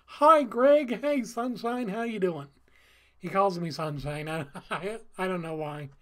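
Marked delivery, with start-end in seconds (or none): 0.1-2.5 imitating a cheerful pastor's loud, high hello; all-smiles greeting. Pastor greeting a churchgoer at the door, cheerful. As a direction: act out a big welcome that settles as it goes. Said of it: "he's all smiles".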